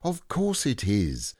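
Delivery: put-on high, slow, delighted boy; Christopher Robin. Christopher Robin answers Eeyore.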